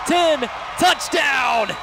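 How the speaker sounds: ordinary delivery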